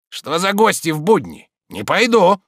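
high, loud, stubborn refusal. A narrator's reading. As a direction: dig in and refuse. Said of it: "упрямо сказал Захар". (упрямый громкий отказ)

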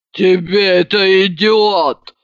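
slow, heavy drawn-out boom; booming bass. A narrator's reading. Drunk friend cheering up the narrator, in a bass boom. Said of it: "пробасила она".

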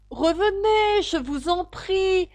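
high, loud, drawn-out pleading shout; calling out. A narrator's reading. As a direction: call after someone walking away, stretching the words. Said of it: "lui cria Alice". (cri implorant, aigu et traînant)

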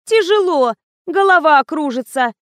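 high-pitched fussy complaint; капризно. A narrator's reading. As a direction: whine like a spoiled model. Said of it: "капризничала модель". (капризная жалоба высоким голосом)

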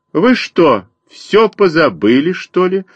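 high scolding bird mother; sparrow mother. A narrator's reading. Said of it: "сказала Воробьиха".

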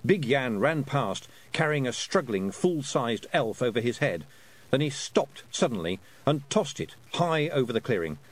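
usual speaking voice

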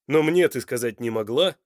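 loud, strained reproach; tense. A narrator's reading. Son asks why his mother kept it from him, tense. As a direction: press her, hurt and tense. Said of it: "напряжённо спросил Данте".

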